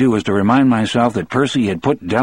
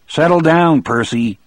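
firm; 6 semitones higher, 3 dB louder than usual. A narrator's reading. Guard calming a struggling man.

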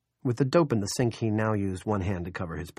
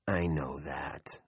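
patient whisper; 5 semitones lower, 6 dB quieter, much slower than usual. Acted. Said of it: "with admirable patience".

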